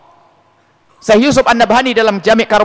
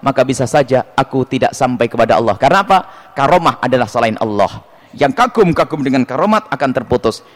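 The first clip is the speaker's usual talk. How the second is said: usual speaking voice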